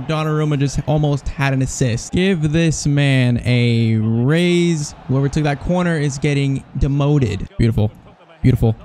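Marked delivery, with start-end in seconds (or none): 2.1-4.9 very slow, stretched-out words; slow, emphatic acclaim; drawn-out praise. Real, not acted. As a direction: draw out each word, especially the last. Mock-grand praise of keeper.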